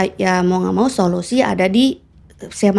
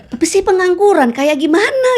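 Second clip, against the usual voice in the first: high, louder retold protest to the doubters; 10 semitones higher, 3 dB louder than usual. To people who doubt her, a high indignant protest. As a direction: voice jumps up in mock disbelief. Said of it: "Saya bilang gitu".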